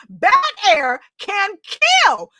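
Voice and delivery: slow, high "Bad air can" then a dropped "kill"; dramatic warning. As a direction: land the danger, one slow beat at a time.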